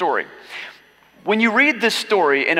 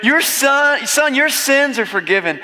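emphatic; 9 semitones higher, 4 dB louder, slower than usual. Acted. Jesus line redone, higher and louder.

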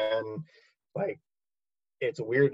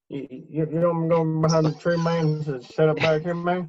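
higher, mumbled Southern voice; 5 semitones higher than usual. Pretend. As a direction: lightly play a rambling country soldier. Voicing the Southern soldier.

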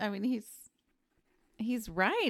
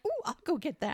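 high-pitched eager imitation; like a dog off after a toy; mock eager; 7 semitones higher than usual. Co-host mimics him fetching the Frisbee, mock eager.

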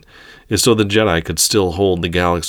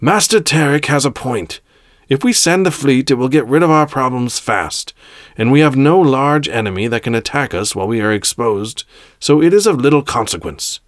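higher, decisive leader's voice; imperious ruling to the council; commanding Grand Master; 7 semitones higher than usual. Acted. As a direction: cut in with authority.

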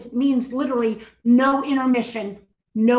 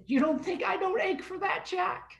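high, softer voiced rebuke; incredulous reply, light and high; God; 8 semitones higher, 4 dB quieter than usual. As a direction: put on a pitched-up, gentle chiding voice. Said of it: "And God just said".

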